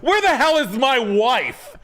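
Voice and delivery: high, fussy put-on realtor voice; realtor impression. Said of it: "And he's like".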